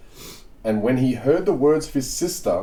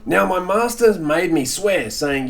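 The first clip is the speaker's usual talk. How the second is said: normal talking voice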